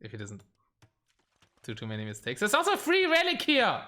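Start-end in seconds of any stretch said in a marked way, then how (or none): 2.4-3.8 high-pitched excited discovery. Real, not acted. Caster spotting an unclaimed relic, excited. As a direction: exclaim it high, as a sudden find. Suddenly spots a free relic.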